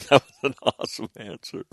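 amused retelling, cracking up halfway through; laughing. Retold or imitated. Quoting the interviewer on the Mufasa answer, laughing. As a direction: break into laughter mid-quote.

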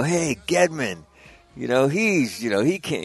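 high-pitched imitated complaint; mock bitching from the ground crew; griping. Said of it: "they're bitching at him".

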